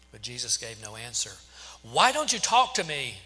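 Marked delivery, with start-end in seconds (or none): 1.8-3.2 loud, high, insistent question; demanding. A narrator's reading. Pilate to the silent Jesus, demanding. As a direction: demand an answer, pitch swooping up. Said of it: "Pilate demanded".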